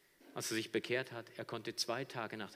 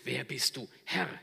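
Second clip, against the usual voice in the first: a touch of trembling awe, near usual voice; moved, trembling question; 3 semitones higher, 6 dB louder than usual. Acted. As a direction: ask it shaken and moved. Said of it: "er fragt ergriffen, bebend". (ergriffen, bebend)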